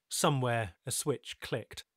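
ordinary delivery

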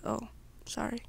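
ordinary delivery

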